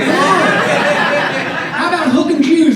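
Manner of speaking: very slow, high, loud drawled twang; Texan twang. Retold or imitated. Pitch guy selling the slogan, hammed-up Texan drawl. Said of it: "put a little twang on it".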